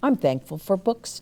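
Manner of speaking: plain reading voice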